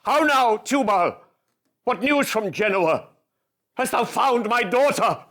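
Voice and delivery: Shylock's anguished cry for his daughter; Shylock, frantic. Acted. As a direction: bark at Tubal as a desperate father. Shylock demands news.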